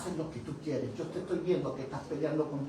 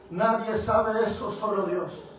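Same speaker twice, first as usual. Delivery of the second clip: emphatic confession; 5 semitones higher, 8 dB louder, slower than usual. Retold or imitated. Man confessing, moved.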